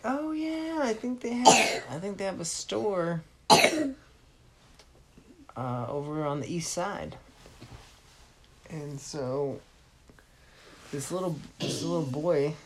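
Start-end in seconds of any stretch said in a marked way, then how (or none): 0.0-7.2 much higher and louder, chirpy helper; a cheerful stranger pointing the way; high bright helper giving directions; helpful stranger voice. Acted. Last person gives directions.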